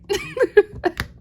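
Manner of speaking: high bright laugh bursting out; burst of laughter. Real, not acted. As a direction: laugh out loud at yourself. Laughing about hating toilet cleaning.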